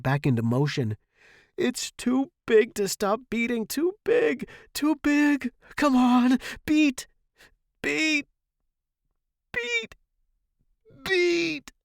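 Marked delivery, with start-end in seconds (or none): 1.6-11.7 loud, very high desperate cries; frantic pleading. A narrator's reading. Student pounding professor's chest, frantic. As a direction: cry out each word like a blow.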